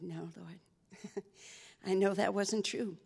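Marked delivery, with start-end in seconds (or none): 0.0-1.2 softer, small voice; sheepish admission. Real, not acted. Answering God, much softer and sheepish.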